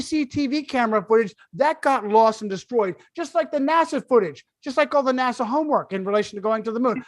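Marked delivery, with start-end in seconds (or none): none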